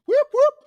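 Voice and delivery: high, loud mock police siren; siren noise. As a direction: imitate a police siren whoop. Police siren imitation in story.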